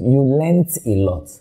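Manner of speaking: learned said "lent", puzzled and slow; confused repeat of lent. Retold or imitated. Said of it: "I'm like".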